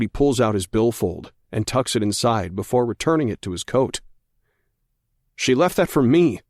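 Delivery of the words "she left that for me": arguing. A narrator's reading. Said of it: "I argue".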